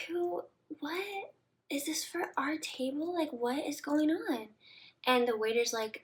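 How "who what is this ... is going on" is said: high, breathy confused questions; mock confusion. Dazed drunk girl asking waiter about the flowers, high.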